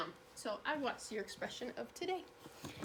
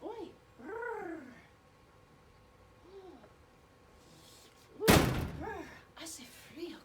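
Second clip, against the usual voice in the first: acting cold; 6 semitones higher, slower than usual. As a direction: act out freezing for the class.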